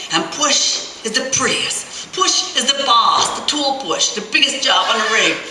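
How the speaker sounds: boastful roughneck. Acted. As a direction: build up the boss like a legend. Ben boasting about boss.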